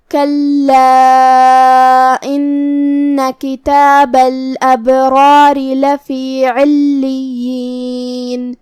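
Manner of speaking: slow melodic chanted recitation with long held vowels; Quranic recitation. Shown as a demonstration. Teacher recites the verse for students to follow. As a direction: recite the verse slowly in tajweed style.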